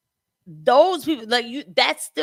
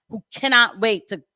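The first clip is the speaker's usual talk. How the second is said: ordinary talk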